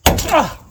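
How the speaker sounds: sudden high yelp of pain; pained cry. Real, not acted. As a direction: yelp as it hurts. Hurt himself on the wrench.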